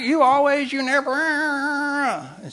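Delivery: high, accusing spouse; complaining spouse. Retold or imitated. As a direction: fire it off like a nagging spouse. Complaining couple voiced.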